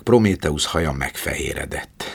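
plain reading voice

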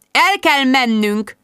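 mystical voice. A narrator's reading. Jude announcing to friends they must leave, mystical drawl. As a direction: draw it out like a mystic in a trance. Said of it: "sajátos, misztikus marcsa hangon".

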